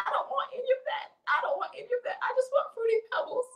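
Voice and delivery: high, hammed-up tearful pleading; laughing while crying. Pregnant worker begging for cereal, laughing while crying. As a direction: whine through mock tears and giggles. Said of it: "I'm laughing while I'm crying".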